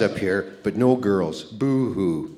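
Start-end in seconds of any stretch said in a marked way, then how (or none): 1.4-2.4 a soft, hammed-up fake boo-hoo; mock crying. Teasing his wife with fake tears over no girls. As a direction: pretend to cry, then give it away. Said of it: "No, I'm just kidding".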